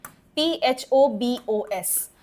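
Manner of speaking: slow dictation; slow spelling. Shown as a demonstration. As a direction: spell E C H O out slowly, letter by letter. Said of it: "spelling a word for class".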